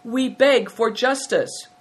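high, slow, formal plea; crying a plea. A narrator's reading. Two boys kowtowing before the prince, crying their plea. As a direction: beg earnestly, stretching the words. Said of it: "cried with one voice".

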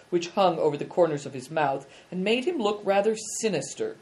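ordinary delivery

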